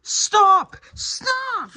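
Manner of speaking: high, piercing shouts to stop a beating; yelling stop. Real, not acted. Stopping Pete from hitting his wife, desperate yell. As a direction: scream at him to stop now.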